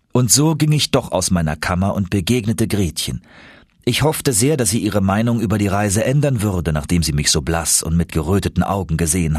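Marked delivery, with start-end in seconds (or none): none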